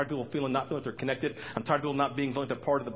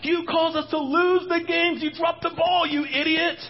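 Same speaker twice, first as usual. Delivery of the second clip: much higher and louder, hammed-up scolding; mock angry body part; 8 dB louder than usual. One hand yelling at the other over a lost game, mock fury much higher and louder. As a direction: berate the hand like a sore loser. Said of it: "this doesn't go".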